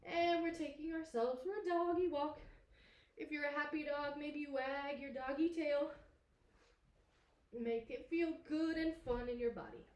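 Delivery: upbeat playful teacher voice; excited. Acted. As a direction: pump up the kids for doggy play. Energetic happy-dog section.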